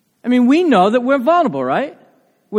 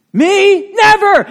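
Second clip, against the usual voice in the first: loud, high, flat refusal; indignant shout, never; emphatic denial; 12 semitones higher, 7 dB louder, much slower than usual. Retold or imitated. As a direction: throw the word out like a vow. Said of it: "Very emphatic".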